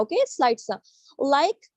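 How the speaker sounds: ordinary delivery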